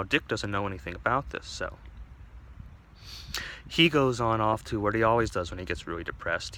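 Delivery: ordinary talk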